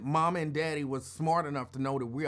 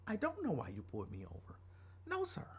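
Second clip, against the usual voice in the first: soft, mock-innocent denial; hushed, wide-eyed innocence; all innocent; 8 dB quieter than usual. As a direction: play the innocent kid, quiet and polite. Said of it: "me, I'm all innocent".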